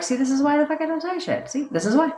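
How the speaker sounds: high, fast exasperated put-on voice; mock the fed-up partner; lightly voiced partner griping at you; imitating the partner. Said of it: "and then they're like".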